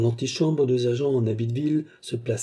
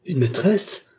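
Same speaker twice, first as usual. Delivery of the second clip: high, louder indignant outburst; higher and louder, shocked indignation; indignant cry; 8 semitones higher, 5 dB louder, slower than usual. A narrator's reading. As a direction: fling the word back, outraged. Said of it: "s'écria Fernand avec indignation".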